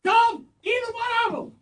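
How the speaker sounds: high mock-injured shouting; shouting while wounded. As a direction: yell for lawyers from the ground. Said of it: "It's kinda incoherently".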